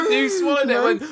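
laughing. Real, not acted. Laughing through the story.

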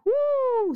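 high excited woo; excited whoop. Real, not acted. Host closing her rant with a high excited whoop.

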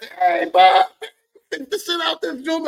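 loud, high delighted laughing shout; laughing praise. Retold or imitated. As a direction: call out, laughing with joy. Happy customer praises, laughing.

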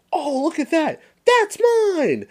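put-on little kid showing off his toys; little brother Randy. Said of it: "and then he goes".

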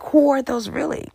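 high, wondering who they are; puzzled high question. Retold or imitated. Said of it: "And I was like".

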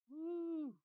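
high, ringing preacher's woo; a sudden high whoop of delight; excited whoop. Real, not acted. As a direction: let out a joyful holler. Excited whoop mid-sermon.

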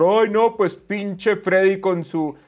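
slow, nagging mock complaint; sneering mock critic voice; mocking whiny critic. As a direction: drag out the whine like an annoying troll. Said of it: "mi acento genérico de persona jodona".

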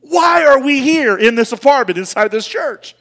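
retold rant. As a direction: let the wife have it, voice climbing. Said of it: "I was just letting her have it".

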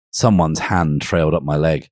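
ordinary delivery

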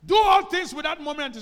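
high, loud cry of the verse; preacher shouting the verse to the congregation; loud scripture proclamation. Shown as a demonstration.

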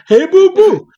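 squeaky, loud cartoon bear hello. Cartoon bear voice after bear talk.

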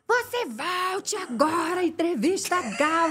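lightly voiced angry boss; stern boss order. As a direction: order her back slowly, each word firm. Boss ordering her back.

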